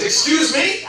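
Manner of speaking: high, rising, affronted challenge; indignant mom. Retold or imitated. Mom hearing her son threatened, acted indignant question. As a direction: imitate a mother taking sharp offense.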